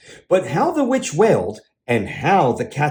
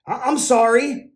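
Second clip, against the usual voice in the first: spluttering; 9 semitones higher than usual. A narrator's reading. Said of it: "he spluttered".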